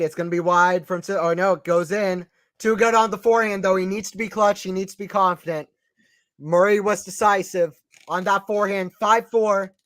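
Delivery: normal talking voice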